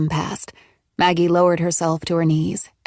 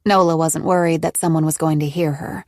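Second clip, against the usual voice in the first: ordinary delivery, nothing marked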